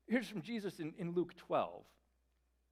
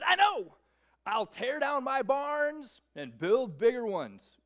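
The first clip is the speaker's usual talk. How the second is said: high, loud and slow self-satisfied plan; the rich man proudly planning bigger barns, excited; 8 semitones higher, 9 dB louder, slower than usual. Acted. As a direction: announce it like a genius. Rich man announcing his plan.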